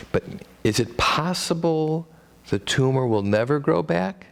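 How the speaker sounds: usual speaking voice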